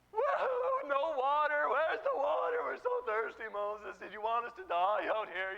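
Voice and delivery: high, loud, tearful complaint; exaggerated crybaby grumbling; mock whining. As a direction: wail like a parched crowd, hammed up. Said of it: "whining, complaining".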